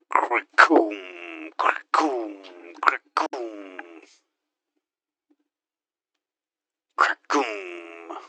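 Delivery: gun noise. Acted. Imitating gun sounds.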